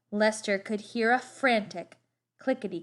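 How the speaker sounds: ordinary delivery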